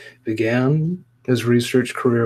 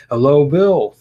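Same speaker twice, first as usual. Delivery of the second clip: louder, raised greeting; 4 semitones higher, 8 dB louder than usual. Real, not acted. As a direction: say hello again, louder and clearer. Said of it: "That was the perfect time for a car to pass by".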